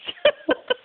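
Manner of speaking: high laugh through sore ribs; painful laugh. Real, not acted. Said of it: "stop making me laugh".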